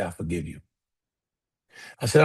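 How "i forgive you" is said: low, quiet forgiveness; softly forgiving. Retold or imitated. Father forgives his son during a hug, hushed. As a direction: lower the voice right down, very soft.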